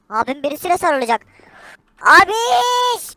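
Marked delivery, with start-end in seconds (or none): 2.0-3.2 high shrill call across the room. Acted. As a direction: yell for big brother. Calling out to brother.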